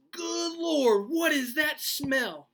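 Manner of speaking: put-on high, loud outraged old-man bellow; a grandpa startled and furious in his underwear; mad grandpa. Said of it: "and he was mad".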